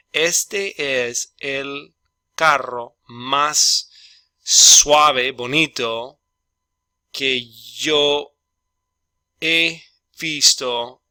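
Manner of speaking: very slow, word by word, with pauses; slow dictation. Shown as a demonstration. Teacher dictating the Spanish translation very slowly. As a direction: spell it out for the class one word at a time. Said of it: "I'm gonna help you with the translating".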